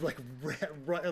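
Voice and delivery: ordinary talk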